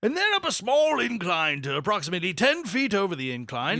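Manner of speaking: put-on high, loud old prospector voice; cranky old-timer, high and loud. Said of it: "I went from the old prospector".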